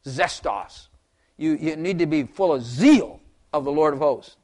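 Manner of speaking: normal talking voice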